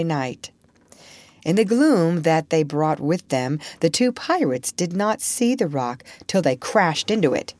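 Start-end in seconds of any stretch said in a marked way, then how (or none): none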